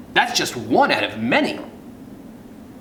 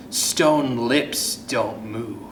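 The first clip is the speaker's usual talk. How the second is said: usual speaking voice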